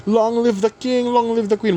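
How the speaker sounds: high, hammed-up cheering shout; mock shouting. Mender of roads cheering the royals, hammed-up high shout. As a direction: cheer like an overwhelmed fan. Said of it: "he began shouting like".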